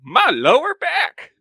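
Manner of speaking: put-on high, loud old-timer voice; old-timey voice. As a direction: croak it like an old man on the radio. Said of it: "the old-timey radio voice".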